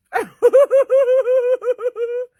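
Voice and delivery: high, loud sustained laugh; roaring real laugh; loud laughter. Real, not acted. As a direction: crack up completely.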